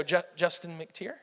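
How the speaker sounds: slow, stumbling, soft and nervous questioning; hesitant, as if unsure of your own name. Retold or imitated. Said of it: "I say my name like it's a question".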